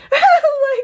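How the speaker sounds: a sudden high squealing laugh; burst of high laughter. Real, not acted. Vlogger cracking up at her own rant, high laugh.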